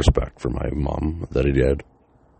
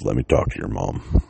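lower, serious biker on the phone; a hint of a grim old biker asking for mom; lightly voiced stern biker; 6 semitones lower than usual.

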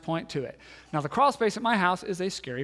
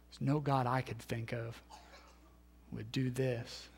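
normal talking voice

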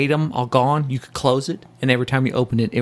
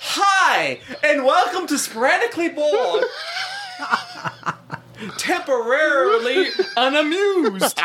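laughing mock intro. Parody podcast intro, laughing.

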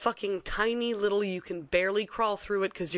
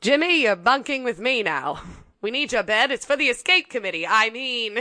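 jokey officer voice; 4 semitones higher, 9 dB louder than usual. Pretend. Voicing a prisoner, loud and jokey.

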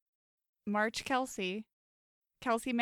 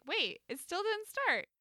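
surprised; 9 semitones higher than usual. Retold or imitated. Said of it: "and I'm like".

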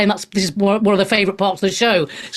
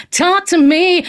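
playful high echo; 7 semitones higher, slower than usual. Shown as a demonstration. Host to listeners, inviting calls, playful high singsong echo. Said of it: "repeats invitation to listeners".

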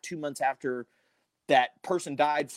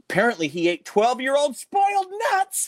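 high, loud joke reveal; pitched-up, projected payoff; punchline delivery; 9 semitones higher, 5 dB louder than usual. Retold or imitated. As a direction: land the punchline big. Delivers hoax punchline on air.

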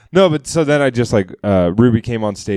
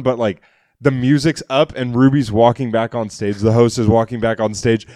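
usual speaking voice

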